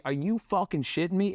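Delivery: ordinary delivery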